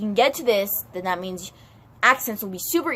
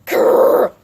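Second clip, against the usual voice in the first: loud mock growl; frustrated growl; 11 dB louder than usual. Student fuming about the Spanish teacher, loud growl.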